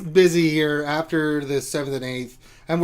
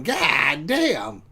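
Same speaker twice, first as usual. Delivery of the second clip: stunned cursing. Acted. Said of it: "even I'm going".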